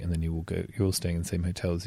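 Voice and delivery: usual speaking voice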